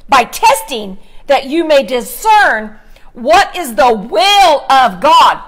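slow, emphatic scripture reading; preacher reading slowly, letting each word land; deliberately slow. Shown as a demonstration. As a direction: stretch each phrase and pause between them. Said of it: "I'm gonna say it slow".

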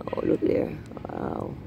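breathy hushed wonder, almost no voice; awed whisper. Real, not acted. Gardener showing her viewers the lily's center, awed whisper. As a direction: whisper it as if afraid to disturb the flower. Marveling at the lily's inner part.

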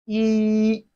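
"yi" held long on one level high pitch; flat tone. Shown as a demonstration. Teacher models Chinese one for learners, flat-tone "yi". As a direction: sustain "yi" without any bend. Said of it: "It has a flat tone".

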